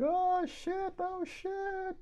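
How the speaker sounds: high squealing panic, repeated; a scared kid squeaking in alarm; kid panicking. Retold or imitated. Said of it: "looking around and screaming".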